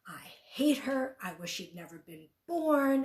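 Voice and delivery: spewing venom. Retold or imitated.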